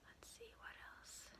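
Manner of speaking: slow soft whisper. Real, not acted. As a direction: whisper it to yourself, slowly. Quiet aside while thinking.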